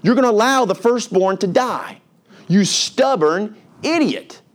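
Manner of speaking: exasperated, high-pitched outburst as Moses; acted frustration, pitched high; frustrated. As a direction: vent at a stubborn man, voice pushed way up. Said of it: "He's frustrated with this man".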